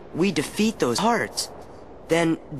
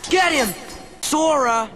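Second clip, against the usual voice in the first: shouted command; 8 semitones higher than usual. Acted. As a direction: yell it at the enemy. Fight starts, calling out.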